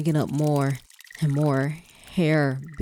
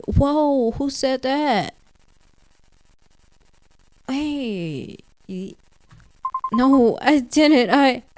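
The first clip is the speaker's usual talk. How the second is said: much higher, louder alarmed denial; startled protest; 10 semitones higher, 6 dB louder, much slower than usual. Real, not acted. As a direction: jump back and deny it. Player startled by ghost accusation.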